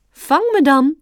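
high, loud playful challenge; calling out. A narrator's reading. Mother deer starting a chase, calling out. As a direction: call out a dare to chase you. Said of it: "riep zijn moeder".

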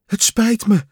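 high, loud and winded; out of breath from chasing the list; panting. A narrator's reading. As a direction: gasp the apology after running. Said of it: "hijgde Kikker".

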